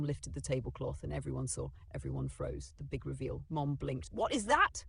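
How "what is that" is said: high, startled demand; shocked question. Acted. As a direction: recoil at the sight. Mom shocked at the reveal.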